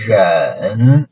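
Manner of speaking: ren dipping low with a slight rise; third tone. Shown as a demonstration. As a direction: drop ren low, a brief lift. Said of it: "make it down".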